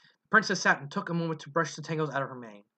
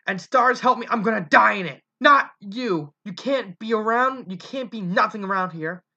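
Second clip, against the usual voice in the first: bitter angry rant; 6 semitones higher, 5 dB louder than usual. Acted. Mother's bitter rant at son.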